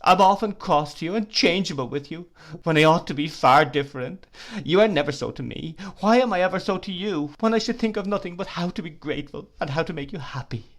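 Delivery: high, loud, tearful pleading; sobbing self-reproach. Acted. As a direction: beg forgiveness through tears. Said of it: "she sobbed".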